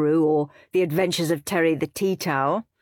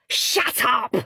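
snapping shut up; angry; 7 semitones higher than usual. A narrator's reading. Henry snapping at his preachy brother, angry. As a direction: cut him off sharply. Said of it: "said Horrid Henry".

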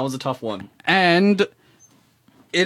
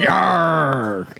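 long growled pirate "yarg". Acted. Radio guest playing pirate for friends, hammed-up pirate voice. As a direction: draw it out like a hammy pirate. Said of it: "won't do a pirate voice".

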